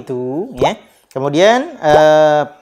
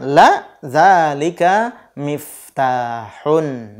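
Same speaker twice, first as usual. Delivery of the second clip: slow answer; 4 dB louder, much slower than usual. Shown as a demonstration.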